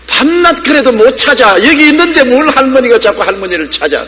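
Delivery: high-pitched mock protest to grandmother; exasperated grandchild. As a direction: act out a child scolding granny, pitched well up. 어린 손주가 할머니에게 따지는 재연. (할머니께 따지는 손주 흉내, 높은 목소리)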